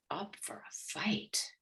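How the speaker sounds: breathy whisper, slowed right down. Real, not acted. Coach confiding to the host, whispering slowly. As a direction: lean in and whisper the last words to a close listener. Ego braced for inner conflict.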